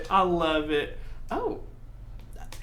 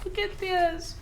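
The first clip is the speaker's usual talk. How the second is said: delighted squeal; 13 semitones higher than usual. Real, not acted. Showing gift to coworker.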